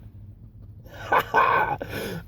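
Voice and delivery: airy unvoiced laugh. Real, not acted. Laughing at the council's irony, a long airy laugh. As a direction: let a long breathy laugh out.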